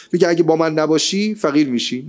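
ordinary talk

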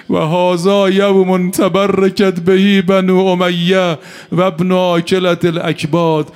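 elegy rising from grave to a loud wailing cry; building lament. Acted. A preacher bringing mourners to tears, building to a loud wail.

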